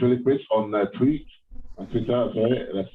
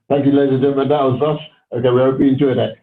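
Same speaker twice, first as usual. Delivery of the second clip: loud, higher showman thanks; excited; 5 semitones higher, 7 dB louder, faster than usual. Real, not acted. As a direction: wrap up like a showman. Host voice after act.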